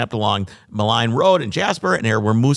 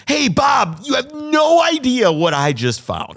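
high, loud goofy moose shout; 13 semitones higher, 3 dB louder, slower than usual. Pretend. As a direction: yell it like an excited dopey moose. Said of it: "That's my moose voice".

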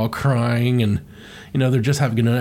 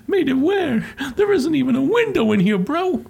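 very high, squeaky protest; mock panic; 12 semitones higher than usual. Trapped sidekick protests to Mario, squeaky mock panic.

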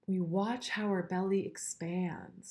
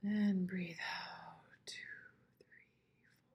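slow whispered counting; whispered breathing guide; 6 dB quieter, slower than usual. Shown as a demonstration. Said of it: "let's just do it once together".

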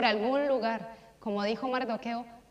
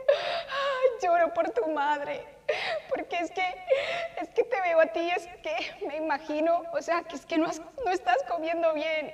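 higher, crying-tinged voice; mock tearful lament, pitched up; imitating tearful friend; 6 semitones higher than usual. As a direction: mimic a friend weeping over you. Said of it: "Zulma se puso a llorar cuando me vio".

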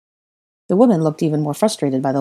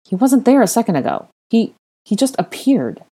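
higher, halting disbelief; bewildered observation; 6 semitones higher, 4 dB louder than usual. Acted. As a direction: think aloud, baffled. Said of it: "speaking his observation aloud".

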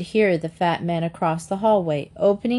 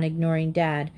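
ordinary delivery, nothing marked

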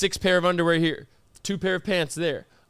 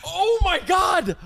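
high, drawn-out "oh my God" in disbelief; astonished, stretched exclamation; shocked outburst; 9 semitones higher, slower than usual. Real, not acted. Friend reacts to seeing the lisp, shocked.

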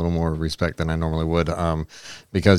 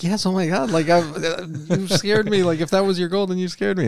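much higher, imitating a panicked yes; imitating scared woman; 11 semitones higher than usual. Woman answering Mister Big, imitated in a high panicky voice. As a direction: mimic a terrified victim squeaking out her answer. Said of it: "she's, like, screaming and everything".

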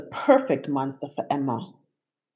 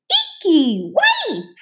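excited; 4 dB louder, slower than usual. A narrator's reading. Child squealing at monster.

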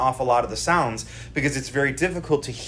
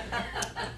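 breathy laugh; 14 dB quieter than usual. Retold or imitated. Kids mocking dad's rapture.

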